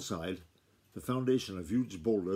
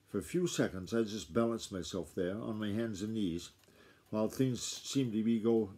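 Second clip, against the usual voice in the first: ordinary delivery, nothing marked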